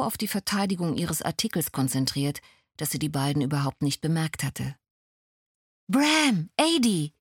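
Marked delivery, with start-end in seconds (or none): 5.9-7.1 high, louder happy call of both names; calling out to old friends in delight; joyful call of greeting; calling out gladly. A narrator's reading. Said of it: "rief sie".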